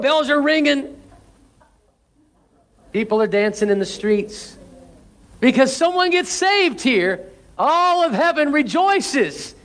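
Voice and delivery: fiery revival preaching; preaching at full force. Acted. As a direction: proclaim it to the whole congregation.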